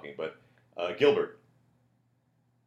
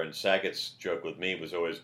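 ordinary delivery

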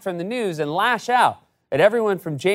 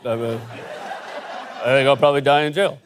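low, hesitant, defeated mumble; low mumbling impression; 9 semitones lower, 4 dB quieter than usual. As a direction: stall with ums, then blurt it fast. Manafort's clip echoed as impression.

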